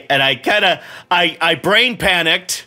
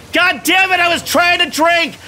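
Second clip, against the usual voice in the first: angry yell; 12 semitones higher than usual. Real, not acted. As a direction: yell it at the screen, fed up. Boss hits him mid-drink.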